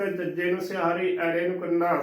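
usual speaking voice

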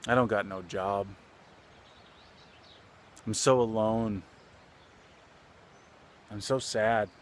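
understated dejection with long pauses between admissions; sad, subdued. Retold or imitated. Laborer confesses his loneliness to old man, subdued and sad. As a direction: let each confession hang, mildly downcast. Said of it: "he gets a sad expression".

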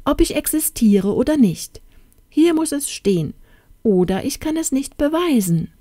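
high-pitched, urgent exclamation; crying out. A narrator's reading. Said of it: "rief sie aus". (aufgeregt, mit hoher Stimme ausgerufen)

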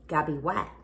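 talking around a bite of chicken; chewing while talking; mouth full. Real, not acted. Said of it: "talk with your mouth full".

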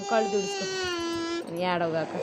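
soft, broken sobbing words; tearful sobbing. Real, not acted. A crying little girl being scolded answers through real sobs. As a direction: cry through the words quietly. Crying child told to wipe eyes.

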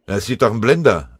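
laut und wütend ausgestoßen; empört losbellen. A narrator's reading. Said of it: "antwortete Scharf wütend". (Scharf wütend zum Melder, laut)

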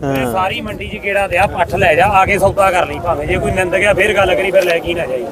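loud market seller pitch; calling out loudly. Real, not acted. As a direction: call it out over the crowd.

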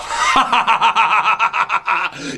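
booming evil laugh; big villain laugh. Acted. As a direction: laugh like a triumphant tyrant. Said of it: "a couple more laughs".

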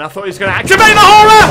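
high fast panicked shout; panicked rush. Real, not acted. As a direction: blurt it out in a panic. Relieved panic.

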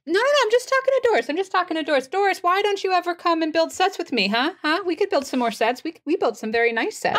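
high, eager protest; high-pitched, chatty pestering; insistent calling back. Acted. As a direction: call down brightly, brushing off the boss. Ginger calls back down, insistent.

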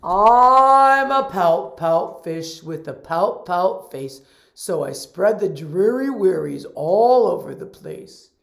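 glum pouty fish voice; gurgling fish man croak. Acted. Fish character's refrain.

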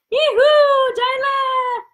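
high, drawn-out celebratory yell; excited whoop. Real, not acted. Teacher celebrating Jayla on a live class, whooping. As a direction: whoop the name out in a high held call.

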